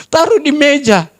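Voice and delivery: ordinary talk, nothing marked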